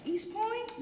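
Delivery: high incredulous mock question; mock-puzzled post office clerk. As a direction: squeak it out like a baffled clerk. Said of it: "even the post office is like".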